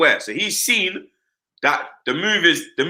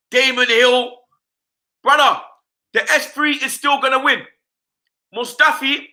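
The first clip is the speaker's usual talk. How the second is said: higher-pitched, fired-up football rant; worked-up rant; 7 semitones higher than usual. Real, not acted. Fan tells his co-host Mustafi is budget, heated rant. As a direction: wind yourself up, scornful and animated.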